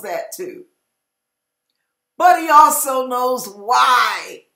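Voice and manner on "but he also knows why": loud, slow, hammered line; preaching shout. Real, not acted. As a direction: shout it out to the pews.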